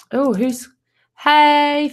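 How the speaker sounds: ordinary talk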